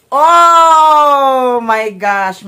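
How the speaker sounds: long, high, loud cry of delight; excited. Real, not acted. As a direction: draw it out, overjoyed. Opening long-awaited silver button.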